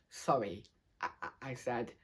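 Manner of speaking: small, low, soft apology; dropped, subdued voice; apologetic. A narrator's reading. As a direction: say it quietly and sheepishly. Child apologising after making a mess.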